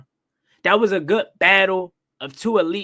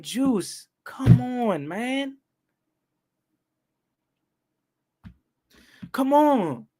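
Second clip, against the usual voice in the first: high, frustrated appeal; fed-up fan's high-pitched plea; exasperated pleading; 7 semitones higher, much slower than usual. Retold or imitated. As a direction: plead with the player in dismay. Pleading with player in retelling.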